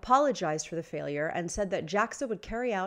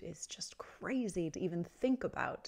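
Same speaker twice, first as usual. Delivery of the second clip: whispering; 7 dB quieter than usual. Real, not acted. Stunned aside about the destruct.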